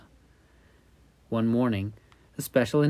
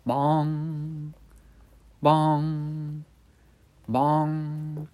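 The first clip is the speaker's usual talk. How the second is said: high held bong like a clock bell; clock chime; 4 semitones higher, much slower than usual. Acted. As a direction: ring it out like a tolling clock. Clock strikes midnight.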